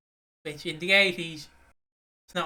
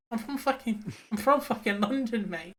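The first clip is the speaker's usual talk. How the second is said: indignant retort; 6 semitones higher than usual. Retold or imitated. Retold indignant pub retort.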